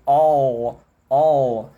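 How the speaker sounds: All with a heavy drawn dark L; strong dark L. Shown as a demonstration. Teacher showing the strong regional L. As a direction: hit the L hard in All. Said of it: "Esse L, ele fica muito forte".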